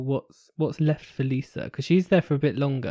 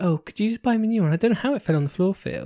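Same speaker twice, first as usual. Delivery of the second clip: mock Sharon; 7 semitones higher, faster than usual. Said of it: "She's like".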